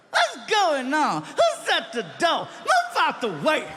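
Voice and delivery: impatient star yelling from the room; Chris Rock impression. Chris Rock voiced from background.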